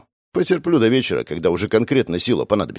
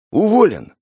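smiling fat broker, higher; smug high voice; 10 semitones higher, 4 dB louder than usual. Acted. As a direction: say it with a smug grin. Smiling new pawnbroker.

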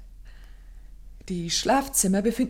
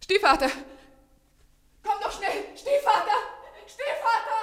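high, loud, panicked calling; desperate scream; 13 semitones higher, 4 dB louder than usual. Acted. Sister screaming for the stepfather to come. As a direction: scream for help down the corridor.

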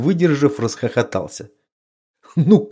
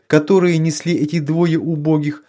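ordinary delivery, nothing marked

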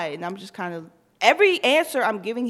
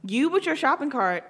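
mocking jab; 3 semitones higher, 7 dB louder than usual.

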